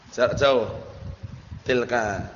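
normal talking voice

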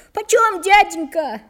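high, loud call; voiced boy, pitched well up and loud; eager boy's question. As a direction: pipe up eagerly to the seller. Hungry boy calls to the seller.

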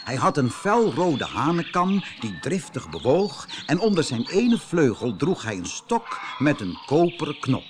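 plain reading voice

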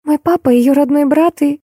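put-on high girlish voice; meek explanation pitched well up; shy girl. A narrator's reading. (застенчивая девушка, тонкий голос)

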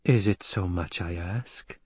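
soft, slow plea; pleading. A narrator's reading. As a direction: beg quietly and slowly. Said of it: "Jessica pleaded".

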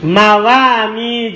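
"Mawami" stretched out slowly; slow, clear model word. Shown as a demonstration. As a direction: say "Mawami" slowly for students to repeat. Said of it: "dihilangkan ya di situ ya". (mengucapkan "Mawami" perlahan)

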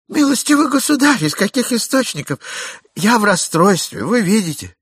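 high, loud, agitated pleading; distraught plea. Acted. Jealous husband pleads with the stranger, distraught. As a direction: beg for an answer in distress.

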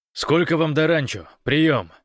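high, loud demand; urgent radio query. A narrator's reading. Гош asks how far the ranch is, urgent and loud. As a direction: demand an answer over the radio.

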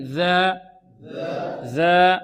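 ذا with tongue tip at the upper teeth, held long; مخرج الذال. Shown as a demonstration. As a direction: clear voiced th in ذا for repeating. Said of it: "انطقوا معي". (ذا من طرف اللسان مع الثنايا العليا)

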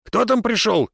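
high-pitched distant call; calling from indoors. A narrator's reading. Wife in the house asks her husband about the visitor, calling out. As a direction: call out to find who has arrived. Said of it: "послышался голос его жены из дома".

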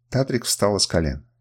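usual speaking voice

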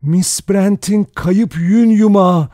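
high, slow, fearful voice; trembling with fear. A narrator's reading. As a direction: quaver in dread, drawing words out. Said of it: "Sesi korkuyla titriyordu". (korkuyla titreyen ses)

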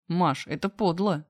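ordinary delivery

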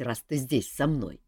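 usual speaking voice

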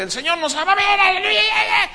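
imitating a shouting preacher; mock revival shout. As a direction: belt it like an overexcited preacher.

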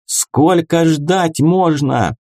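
high, drawn-out complaint; slow, stretched call from the mob; impatient crowd. A narrator's reading. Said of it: "поддержал его народ".

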